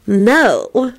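high, wavering refusal; refuse, but let the voice waver. A narrator's reading. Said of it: "but her voice wavered".

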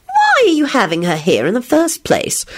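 high, impulsive question; impulsive blurt. A narrator's reading. Rosie questions the invitation, blurting it impulsively. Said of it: "Rosie blurted out in her impulsive fashion".

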